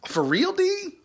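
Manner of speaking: a startled, high "for real?"; surprised. Real, not acted. Reacting to a viewer's comment, shooting up high in surprise. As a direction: pitch jumps way up in disbelief.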